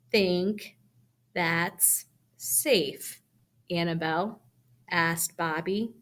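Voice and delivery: halting, flat, word-by-word delivery; slow robotic read with no expression; reading too slow. Shown as a demonstration. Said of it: "It also didn't have any expression".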